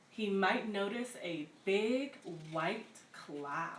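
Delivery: slow, lively storybook read-aloud with little pauses; picture-book narration, unhurried and bright; reading to children. Acted.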